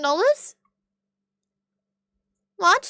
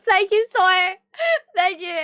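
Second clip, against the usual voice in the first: touched thanks; 6 semitones higher, 4 dB louder than usual. Real, not acted. Reacts to chat's flattery.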